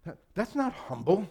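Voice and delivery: mock the listener who won't buy it; incredulous retort. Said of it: "You would go".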